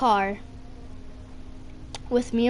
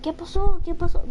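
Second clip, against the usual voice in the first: worried relatives; 6 semitones higher than usual. Retold or imitated. Family arriving alarmed at hospital.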